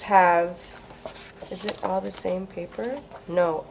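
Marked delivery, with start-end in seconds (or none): none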